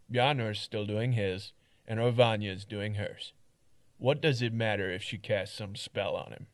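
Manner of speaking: firm, weary guard telling it straight; blunt, tired town guard; gruff guard voice. Acted. Third take of Mart.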